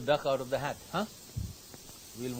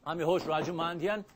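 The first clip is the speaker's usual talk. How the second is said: normal talking voice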